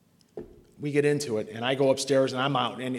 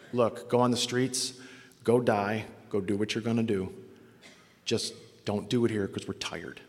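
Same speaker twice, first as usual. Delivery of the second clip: very calm; 5 semitones lower, 5 dB quieter than usual. Retold or imitated. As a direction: imitate a tired mother calmly giving up, pitched down. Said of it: "she very calmly says".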